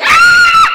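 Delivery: long, very high, loud comic shriek; high-pitched cry. Acted. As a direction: let out a piercing held "ah". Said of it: "And I was like".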